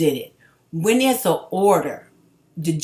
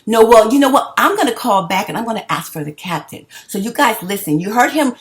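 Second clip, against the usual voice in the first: raised, heated voice; angry; 6 semitones higher, 7 dB louder than usual. Real, not acted. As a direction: confront him loudly. Arguing with an official on the phone.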